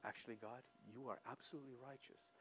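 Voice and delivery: ordinary delivery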